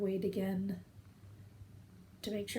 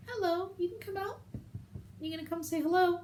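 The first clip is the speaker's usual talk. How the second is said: cat talk; 10 semitones higher than usual. Real, not acted. Talking sweetly to her cat.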